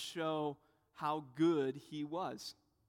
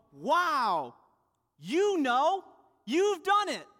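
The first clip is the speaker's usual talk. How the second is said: loud, high mock amazement; hammed-up awe, as if hugely impressed; exaggerated congratulation, calling it out; gushing praise; 7 semitones higher, 9 dB louder, much slower than usual. Imagined Jesus gushing with praise.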